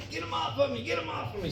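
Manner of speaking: high, soft mock shriek; mock panicked yell. Stepmom yelling at dad while covered in ants, mock panicked.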